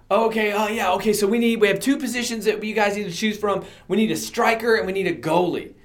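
high, busy organiser voice; imitated coach rattling off what's needed; coaches recruiting players. Acting out soccer coaches recruiting players.

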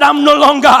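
repeated as a shout, NO hit hardest. Real, not acted. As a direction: shout "I'm no longer" back at them. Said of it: "I said".

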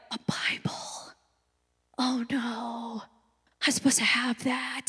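very soft, half-whispered panic under the breath; hushed dismay. Acted. Kid caught without a Bible at family study, hushed dismay. Voicing herself as distracted child caught out.

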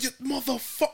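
high mock yell of an angry wife; retold yelling. Wife cursing at the cab driver, high-pitched mock yell. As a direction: imitate her screaming insult up high. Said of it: "All I heard was her like".

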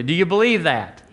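usual speaking voice

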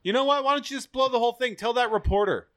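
high, fast acted urging; mock exasperation. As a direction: rush it out like a fed-up butler. Acts out Alfred's exasperated urging.

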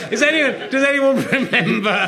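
laughing mock question. Mock quizzing about old references.

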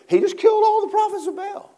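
high and fast, swept up; rapid excited preaching. Acted. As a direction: tell it like a thrill. Preacher builds to excited telling.

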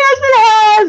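high, loud mock-hysterical protest; retold meltdown. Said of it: "I'm doing the whole thing".

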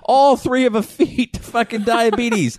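put-on high-pitched, worked-up Boston accent; hammed-up Boston local. Bostonian bragging about mother losing feet, comic Boston rant. Improvised angry Boston donut lover.

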